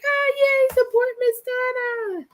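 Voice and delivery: high squealing cheer; excited squeal. Retold or imitated. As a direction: squeal it with delight. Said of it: "I was like".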